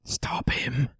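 eerie whisper; creepy whisper. Retold or imitated. Quoting the first One for All user as a creepy whisper. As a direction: hiss it like a ghost in his head. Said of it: "he's being creepy as shit".

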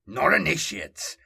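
tense, angry edge; edged with anger. A narrator's reading. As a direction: let anger edge the words. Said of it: "Zal's voice was edged with anger".

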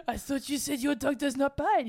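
high, loud comic French protest; indignant Pink Panther detective. Retold or imitated. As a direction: protest as the bitten detective, laughing. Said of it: "He says".

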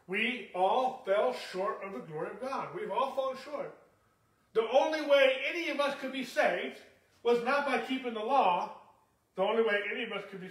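fired-up sermon delivery; preaching at full force. Real, not acted. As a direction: drive the point home to the congregation.

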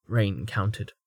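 plain reading voice

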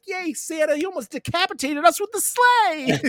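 sarcastic hammed-up hooray; mock cheer. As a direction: cheer while complaining.

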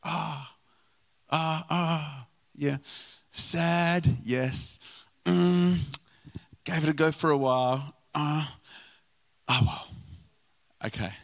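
mock offhand sighs, shrugging it off; dismissive. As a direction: sound like you can't be bothered.

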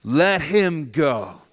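slow, loud, firm order; commanding demand. Pretend. Preacher demanding the kidnapper free the hostage, slow and firm. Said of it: "I look at your kidnapper and I say".

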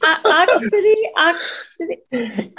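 laughing Southern Georgia accent mispronunciation. Real, not acted. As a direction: stumble over 'oxidy' in a Georgia drawl. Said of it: "it might just be your Georgia accent".

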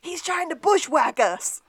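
high, loud hammy cowboy shout; cowboy alarm. Retold or imitated. Sean shouting a warning about an ambush in a hammy cowboy voice. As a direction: holler it like an old Western.

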